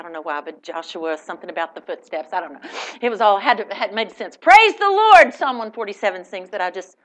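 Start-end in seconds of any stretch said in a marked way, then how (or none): 4.4-5.3 louder, high proclamation; louder proclaimed praise; proclaiming. Acted. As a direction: announce praise boldly. Reading psalm loudly.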